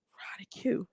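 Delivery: breathy whisper of the name; a soft teasing whisper. Real, not acted.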